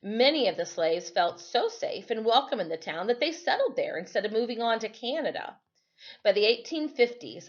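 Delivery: steady read-aloud narration for the class; story reading. Acted. Teacher reads history story aloud.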